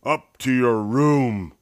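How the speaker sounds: mild bear roar of a fed-up dad. A narrator's reading. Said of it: "roared Papa".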